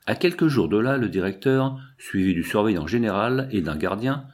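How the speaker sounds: plain reading voice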